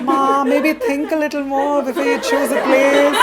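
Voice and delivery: high, loud plea to Mom; mock-scandalised son; comic exasperation. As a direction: protest it loudly over the laughs. Acted protest to mom, comic aside.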